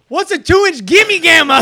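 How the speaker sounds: "gimme gamma" with hard g's, loud and high; slow, loud, baffled dad saying "gimme gamma"; dad's misreading. Retold or imitated. Son mimics dad misreading "gimme gamma", baffled, both g's hard. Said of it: "his dad goes".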